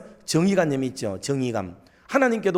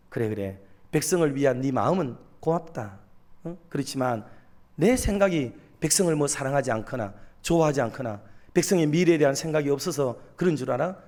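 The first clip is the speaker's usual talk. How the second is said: lowered, soothing fatherly talk; calm the prophet, voice kept low; gentle, embracing reassurance; 3 semitones lower than usual. Acted. God comforting the prophet and asking him to wait, tenderly. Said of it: "하박국을 안아주는 거죠".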